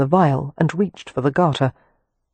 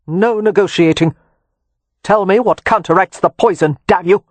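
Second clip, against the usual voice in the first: angry demand; 4 semitones higher, 5 dB louder than usual. A narrator's reading. Leo demands the antidote.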